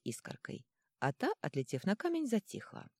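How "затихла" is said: ordinary delivery, nothing marked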